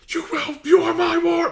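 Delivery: high, loud mock yell of an angry guardian; Mycroft shouting. Guardian yelling at his defiant ward, high and loud. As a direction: bellow it up high like a furious guardian. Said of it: "And he goes like".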